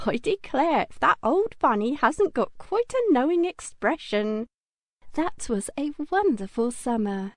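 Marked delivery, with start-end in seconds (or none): 0.1-4.5 lightly voiced old nurse, a touch higher; fond old nanny marvelling at the toy; a hint of a gentle old nanny; Nana's voice. A narrator's reading. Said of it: "and said".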